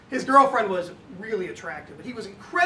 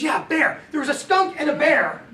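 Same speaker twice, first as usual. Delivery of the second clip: higher, louder, lightly voiced child; young son storytelling; 5 semitones higher, 5 dB louder than usual. Son building a story with dad, lightly voiced young storyteller. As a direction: imitate a little boy agreeing eagerly.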